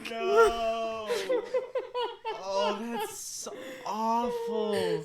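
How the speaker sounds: high, slow laughing whine; laughing in disbelief. Real, not acted. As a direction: squeal it out, laughing. Reacting to the sad story.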